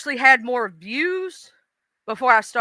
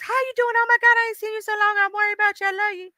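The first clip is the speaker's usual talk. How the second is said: very high-pitched, gushing hello; gushing greeting; 12 semitones higher, faster than usual. Acted. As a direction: squeal a rapid, affectionate greeting in a high voice. Acted greeting of a fan met elsewhere.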